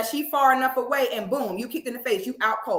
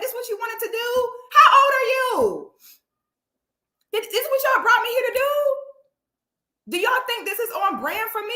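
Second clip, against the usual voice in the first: outraged rant; 10 semitones higher than usual. Real, not acted.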